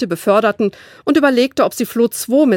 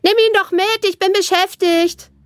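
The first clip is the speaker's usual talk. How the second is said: put-on much higher, dismissive little-girl voice; bratty little sister; 12 semitones higher than usual. A narrator's reading. Little sister Caro brushes Flo off while dancing, much higher and dismissive.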